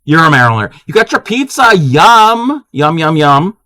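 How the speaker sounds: normal talking voice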